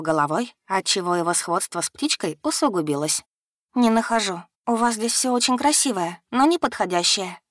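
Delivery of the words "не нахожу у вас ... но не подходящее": usual speaking voice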